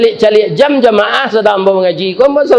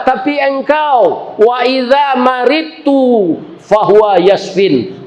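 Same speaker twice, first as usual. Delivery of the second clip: slow, drawn-out higher chant of the verse; solemn recitation; 6 semitones higher, slower than usual. Acted. Director reciting the verse to God in his prayer, slow and solemn.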